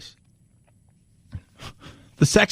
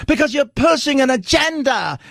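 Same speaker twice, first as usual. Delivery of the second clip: mock caller voice; 7 semitones higher than usual. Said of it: "an accent that disappeared".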